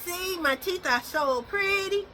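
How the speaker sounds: high, slow cutesy dog voice; voicing the dog. Owner voicing her dog for viewers, high cutesy voice. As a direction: speak for the dog in a squeaky little voice.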